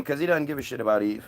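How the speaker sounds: ordinary talk, nothing marked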